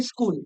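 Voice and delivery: স্কুল with an "i" added in front, "iskul"; grandmothers' pronunciation. Retold or imitated. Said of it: "এবার তোদের দিদা বা ঠাকুমারা কি বলবে".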